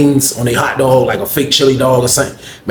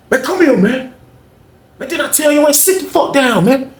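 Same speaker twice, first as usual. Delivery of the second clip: much higher scolding call; imitating Charles scolding; bossy inmate; 12 semitones higher than usual. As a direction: haul him back and chew him out.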